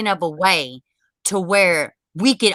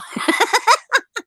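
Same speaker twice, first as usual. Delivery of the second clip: high burst of laughter; 13 semitones higher than usual. Real, not acted. Joke about charging people.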